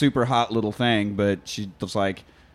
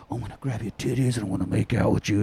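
softer mock-lecherous voice; perverted; 2 semitones lower, 5 dB quieter than usual. Boyfriend to the intercom while a kid watches, perverted. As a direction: murmur it as a creepy lover parody. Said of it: "he's, like, getting all perverted".

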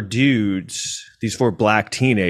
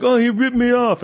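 much higher put-on complaining voice; imitating the woman; 13 semitones higher, 3 dB louder than usual. Narrator mimics the woman accusing his brother-in-law, much higher mock voice. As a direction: whine it up high like her. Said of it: "And she's like".

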